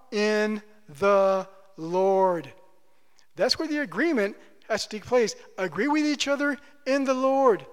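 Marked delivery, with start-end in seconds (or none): 0.1-2.5 very slow answer. Shown as a demonstration. Pastor answering his own question, very slowly. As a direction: stretch every word out.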